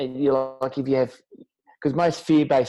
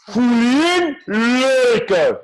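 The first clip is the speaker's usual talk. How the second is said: higher still, loud and slow; Wim Hof impression; 9 semitones higher, 6 dB louder, much slower than usual. Repeating Wim Hof after the praise, high and loud. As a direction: encore of the guru breath cue. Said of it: "It's the perfect Wim Hof impression".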